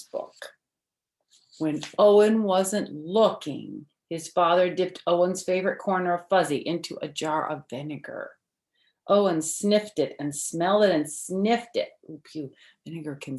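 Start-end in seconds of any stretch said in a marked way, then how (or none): 1.6-11.9 bright, lively storybook read-aloud; reading to children. Acted. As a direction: read it like story time for little kids. Reads picture book to children.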